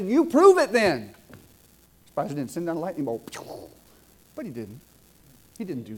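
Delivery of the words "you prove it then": loud, high, slow defiant shout; a young agnostic yelling a challenge at God; retold yell. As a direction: dare God to prove himself, loudly. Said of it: "I yelled and I said".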